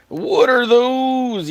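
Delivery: high, loud, very slow "What are those?"; drawn-out meme yell. Retold or imitated. Meme line said twice.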